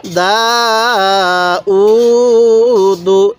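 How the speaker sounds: দা and উ held long, দু short; one-alif elongation. Shown as a demonstration. Teacher showing how to read it, drawn-out দা উ, short দু. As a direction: stretch দা, stretch উ, then close briefly on দু. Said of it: "এরকমভাবে শিখব".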